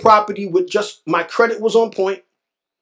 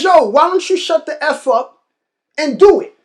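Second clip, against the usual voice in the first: higher, louder imitation of a Grenadian old-timer; Grenadian accent; 8 semitones higher, 5 dB louder than usual. Old-timer pushing a big talker to act, Grenadian accent. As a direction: challenge him in a lightly imitated Grenadian accent. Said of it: "in his Grenadian accent".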